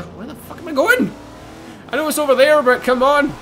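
high, loud frustrated outburst; exasperated. Real, not acted. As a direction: complain loudly at being lost. Lost in the race, exasperated.

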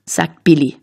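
plain reading voice

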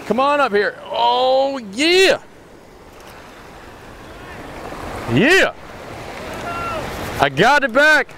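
high, jubilant celebration; real elation, voice shooting up; triumphant cheer. Real, not acted. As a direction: crow over landing it. Lands the fish, cheering.

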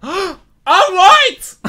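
ordinary delivery

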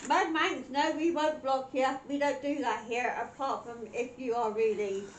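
ordinary delivery

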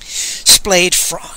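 ordinary delivery, nothing marked

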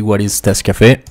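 usual speaking voice

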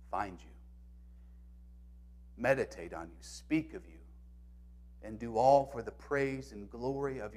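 very soft, low, reverent prayer; quiet, unhurried devotional reading; slow prayer reading. Real, not acted. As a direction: read it hushed and slowly, with long pauses after each phrase. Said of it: "I'm gonna read it slowly".

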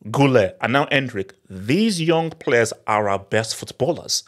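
ordinary talk

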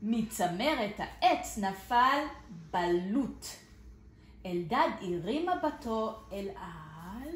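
lively storybook reading with big swings; reading to children. Acted. Teacher reads a picture book to small children, lively and expressive. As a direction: read it out like a kindergarten story.

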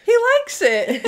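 very high, loud mock protest; acted protest. Retelling a TV mother's line about her son, mock protest. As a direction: pitch it way up, hammed-up. Acting out a quoted show line.

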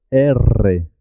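vibrating R in erre; trilled R. Shown as a demonstration. Teacher repeats the letter name so it vibrates, rolled R. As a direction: roll the R of erre firmly. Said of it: "vibrar el sonido".

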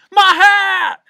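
very high, full-volume wail; outraged shout. A narrator's reading. Bug whose hat was knocked off, outraged shout. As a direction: bellow it in high protest. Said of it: "shouted the bug".

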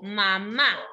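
"mamá" with the stress on the final "má"; acento en la segunda sílaba. Shown as a demonstration. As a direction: lean on the last syllable of "mamá". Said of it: "Es donde suena más fuerte". ("mamá" con más fuerza en la última "má")

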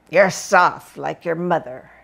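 father's put-down. Retold or imitated. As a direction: sneer it like a hard military dad. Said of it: "he'd say".

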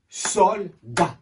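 "Soldat" in two evenly spaced beats; syllable by syllable. Shown as a demonstration. Teacher counting claps with pupils, syllable by syllable. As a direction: break "Soldat" clearly into Sol, dat. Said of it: "J'ai tapé deux fois".

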